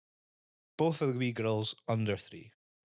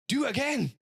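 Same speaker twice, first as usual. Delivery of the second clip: put-on much higher, louder child voice; a hammed-up eager child; the kids begging him to go again, eager child voice; demanding kid; 5 dB louder than usual.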